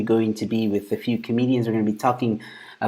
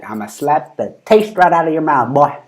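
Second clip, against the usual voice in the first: louder, higher pretend tough talk; mock threat; 5 semitones higher, 6 dB louder than usual. Streamer rehearsing for next time threatened, mock threat. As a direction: play at threatening someone with a borrowed catchphrase. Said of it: "Next time I feel threatened".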